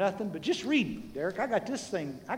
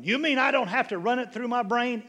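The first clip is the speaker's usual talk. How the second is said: high, louder incredulous question; incredulous listener; 7 semitones higher, 5 dB louder than usual. Acted. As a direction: mimic a doubting churchgoer in a raised pitch. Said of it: "some of you going".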